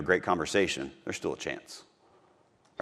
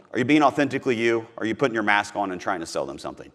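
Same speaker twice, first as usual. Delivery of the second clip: usual speaking voice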